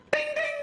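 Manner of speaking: two high pinging dings; impatient double chime; double call bell ding. Retold or imitated. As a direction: ring the bell twice, high. Call button twice.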